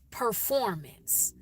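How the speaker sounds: performance with a full "per" at the start; careful standard performance; clearly pronounced. Shown as a demonstration. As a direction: say performance slowly, sounding the R. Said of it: "I'm saying things clearly".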